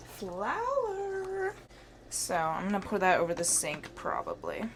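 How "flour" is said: drawn-out, higher creepy butler voice on flour; Riff Raff voice. Retold or imitated. Cooking vlogger doing Riff Raff, high drawn-out creepy voice. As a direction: stretch the word out like Riff Raff. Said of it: "That was Riff Raff".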